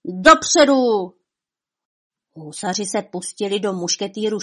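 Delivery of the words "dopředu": loud, high, drawn-out charge cry; battle shout. A narrator's reading. Honza at the head of his hussars, shouted charge. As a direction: shout it long to send troops forward. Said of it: "a křikl".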